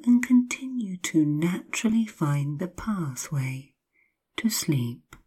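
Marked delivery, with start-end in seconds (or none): none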